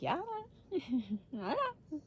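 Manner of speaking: high, slow, breezy "yeah I know"; mock sweet high voice. Telling the nurse she knows, mock sweet high voice. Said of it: "And I'm like".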